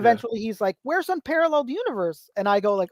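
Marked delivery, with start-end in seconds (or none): 0.8-2.3 lightly voiced curious kid, higher; a hint of kid brother asking; little brother's voice. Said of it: "you do the voice".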